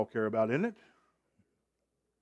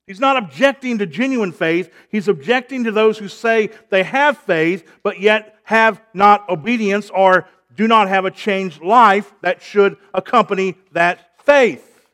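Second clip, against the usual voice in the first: loud, punched pulpit delivery; emphatic preaching; 3 semitones higher, 7 dB louder than usual. Real, not acted. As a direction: hammer out the argument to the congregation. Preaches at full force.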